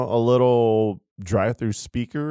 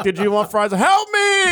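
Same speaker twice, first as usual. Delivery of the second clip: drive thru speaker; 9 semitones higher, 4 dB louder than usual. Pretend. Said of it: "It's like".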